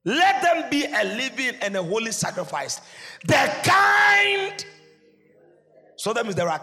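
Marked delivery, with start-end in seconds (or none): 3.3-4.6 high, loud shout; fiery preacher's shout; preaching shout. Real, not acted. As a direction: shout the phrase to the back row. Preacher shouts the key phrase.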